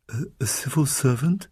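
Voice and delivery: halting, stammered question; thick-voiced. A narrator's reading. Pandora learning of her brother's job, thick-voiced. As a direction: stumble thickly into disbelief. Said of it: "said Pandora thickly".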